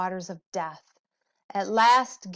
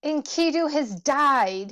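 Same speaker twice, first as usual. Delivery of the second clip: higher, louder grieving cry; 6 semitones higher, 5 dB louder than usual. A narrator's reading. Gilgamesh mourning Enkidu while wandering, raised and grieving. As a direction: mourn his friend aloud. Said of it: "he grieved".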